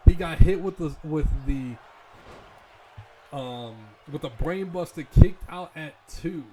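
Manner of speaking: loud, slowed stunned call; excited. Acted. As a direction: sell the shock of the kickout. Stunned kickout commentary.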